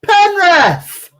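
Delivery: loud high penalty appeal; yelling from the sidelines. Real, not acted. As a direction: demand the penalty.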